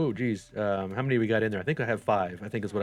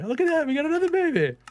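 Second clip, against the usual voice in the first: much higher, squealing with delight; excited; 3 dB louder than usual. Real, not acted. Another baby appears.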